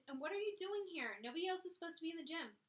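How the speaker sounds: high, fast indignant questions; demanding. Acted. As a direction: press the questions hard and fast. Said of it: "she demanded".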